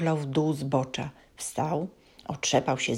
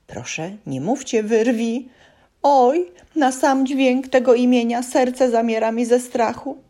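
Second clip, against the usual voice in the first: frightened elf; 7 semitones higher, 5 dB louder than usual. A narrator's reading.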